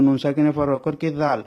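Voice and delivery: ordinary talk, nothing marked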